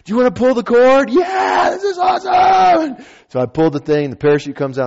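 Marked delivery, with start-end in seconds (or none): 0.1-3.1 very high, strained shout, stretched out at the end; imitated excited yelling. Skydivers in freefall about the cord, giddy imitated yelling. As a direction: holler giddily over rushing wind. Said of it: "he's yelling".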